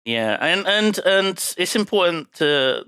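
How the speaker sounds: ordinary delivery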